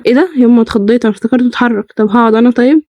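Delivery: normal talking voice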